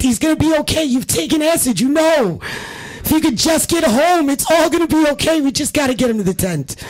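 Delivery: high, urgent pep-talk pleading; rallying the troops. Retold or imitated. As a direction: coax the tripping friend home, pushing hard. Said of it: "rallying the troops".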